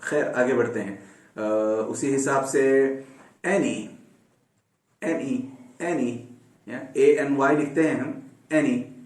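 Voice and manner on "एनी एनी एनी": soft एनी with a short e; model word. Shown as a demonstration. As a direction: say एनी with the e of bed. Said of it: "इसमें शुरू में ए का sound आएगा".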